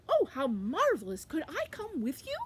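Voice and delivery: high, swooping eager storybook voice; gush, then ask with pitch leaping up; delighted ladder. Acted. Ladder asking to join Quackling, high delighted voice. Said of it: "the ladder said".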